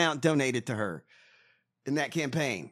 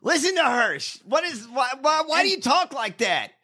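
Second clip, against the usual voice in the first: exasperated; 8 semitones higher, 4 dB louder than usual. Real, not acted. Exasperated mock confrontation over a politician's voice.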